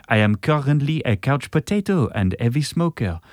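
hammed-up French accent reading a letter; saucy French accent. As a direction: read it as a French letter-writer. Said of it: "do your sauciest French accent".

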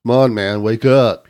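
loud frat bro wake-up call; a lightly voiced frat bro shout. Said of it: "he shouted".

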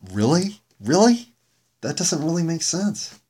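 high-pitched, doubting repeated question; incredulous. Real, not acted. Host doubting ex-girlfriends explain his emotion, incredulous.

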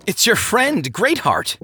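high-pitched, cheery announcement; hearty reminder. A narrator's reading. Greatheart reminds his drunk friend who he is, heartily. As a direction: jog a drunk friend's memory brightly.

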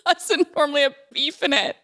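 high, slow, laughing mock indignation; indignant sister. Sister imitating her huffy soup complaint, laughing.

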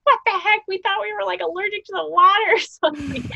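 high, loud disbelief; incredulous friends. Retold or imitated. Teammates reacting to the prank reveal, high and incredulous. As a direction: voice the whole team's shock.

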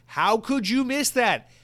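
high, loud and slow, pleading in disbelief; exasperated outcry. Real, not acted. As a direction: stretch it out, really upset. Ranting at BJ's about food court.

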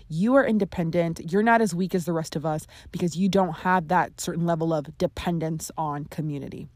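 ordinary delivery

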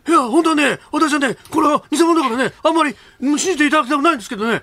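high, pinched politician impression; Suzuki Muneo impression. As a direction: play the famous politician, pitched high. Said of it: "鈴木宗男さんも同席してくださってます". (鈴木宗男のものまね)

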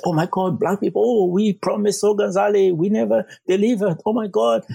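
high-pitched, flustered imitation of city staff; mock dismayed officials. City Hall people remembering a broken promise, mock dismay. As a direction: mimic officials suddenly realizing their failure, pitch way up. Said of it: "people in the City Hall".

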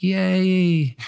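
high, drawn-out little cheer; playful, long "yay"; mock cheer. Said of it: "I might think".

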